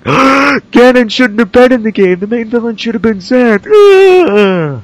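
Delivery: high, loud, slow whiny fan voice; mocking whiny fans. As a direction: whine like a fickle fan. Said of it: "people are like".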